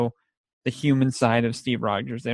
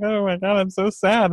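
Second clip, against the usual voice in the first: higher mock sad daughter voice; imitating daughter; 7 semitones higher than usual. Retelling the family cry, a higher mock daughter voice. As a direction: play the weepy daughter lightly. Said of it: "she was like".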